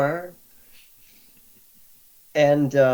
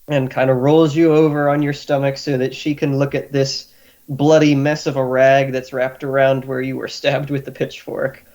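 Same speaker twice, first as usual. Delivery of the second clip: usual speaking voice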